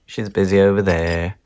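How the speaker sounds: ordinary delivery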